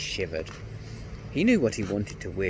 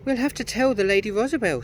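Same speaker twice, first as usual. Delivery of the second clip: put-on tearful girl; 13 semitones higher, 5 dB louder, faster than usual. A narrator's reading. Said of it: "tears running down her cheeks".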